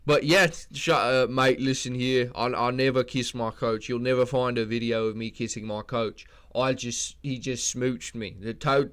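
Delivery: lightly put-on South African accent; mock Drickus. Drickus brushing off a reporter, slight South African accent. Drickus impression answering question.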